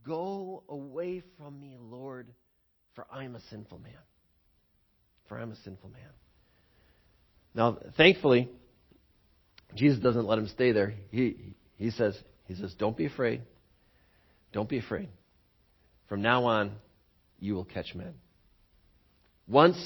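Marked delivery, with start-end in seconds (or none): 0.1-6.1 hushed confession. Acted. Kneeling Peter begging Jesus to leave, hushed. 12.8-18.1 calm him gently; tender reassurance. Acted. Jesus reassuring the fallen Peter, low and tender.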